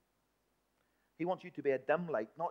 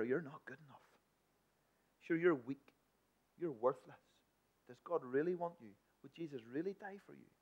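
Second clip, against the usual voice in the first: hushed, much softer tempting voice; insinuating whisper; 10 dB quieter than usual. Acted. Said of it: "he will whisper into your ear".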